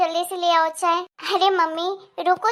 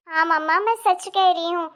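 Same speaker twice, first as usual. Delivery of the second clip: normal talking voice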